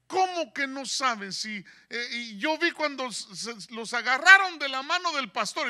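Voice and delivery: demanding his tickets back, furious; retold angry yelling. Friend yelling at airline staff, outraged shout. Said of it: "les empezó a gritar y a gritar".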